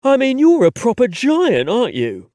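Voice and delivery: put-on London accent, wry and amused. A narrator's reading. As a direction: tease him in a London accent. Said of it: "his London accent".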